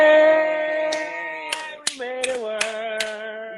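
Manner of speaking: very high, drawn-out cheer; real high, stretched-out delight; excited celebration. Real, not acted. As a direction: sing out the greeting high and long. Celebrates that connection finally works.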